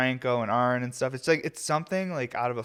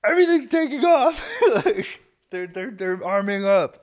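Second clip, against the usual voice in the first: much higher, louder hammed-up panic with laughter; mock alarm post; 14 semitones higher, 9 dB louder than usual. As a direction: play a frantic poster, laughing. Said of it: "we'll be like, on Instagram".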